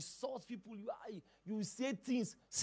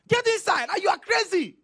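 high, loud yelling at a wife; man yelling at his wife, furious; furious shout; 13 semitones higher, 16 dB louder than usual. Retold or imitated. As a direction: scream insults in a rage. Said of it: "You are shouting at your wife".

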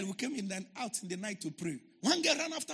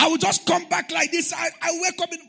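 louder, higher preacher's call; preaching shout; 9 semitones higher, 11 dB louder than usual. Acted. Pastor telling the congregation about his prayers, preaching shout. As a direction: proclaim it out.

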